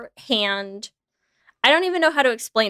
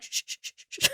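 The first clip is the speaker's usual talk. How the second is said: unvoiced, much softer shush; 11 dB quieter, faster than usual. Friend soothed with a raccoon-style shush. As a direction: hush it away gently.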